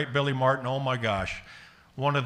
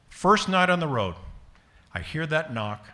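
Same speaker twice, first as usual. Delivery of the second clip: ordinary delivery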